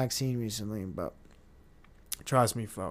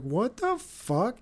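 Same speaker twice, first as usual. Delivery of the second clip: disbelief; 8 semitones higher, slower than usual. Retold or imitated. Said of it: "You're like".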